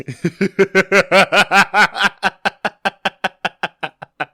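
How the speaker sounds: long, high rattling laugh; helpless high cackling that runs on and on; long cackle. Real, not acted. Laughing at six hundred against forty.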